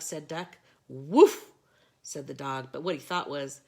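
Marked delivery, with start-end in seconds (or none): 0.9-1.5 loud, punchy dog bark; mock woof. A narrator's reading. Dog barks.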